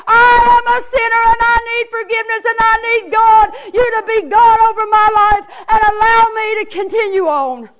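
high, loud, driving revival cadence; impassioned altar-call cry; full-force preaching. Real, not acted. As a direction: shout it out with sustained fervour, drawing out the climaxes.

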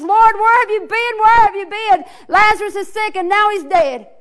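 plead, then shout in distress; the sisters wailing at Jesus; grieving family. Acted. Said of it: "Lazarus' family runs out to him and says".

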